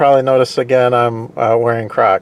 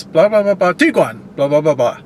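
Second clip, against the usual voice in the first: mock ad announcer. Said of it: "So in other words".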